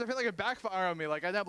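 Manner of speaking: ordinary talk, nothing marked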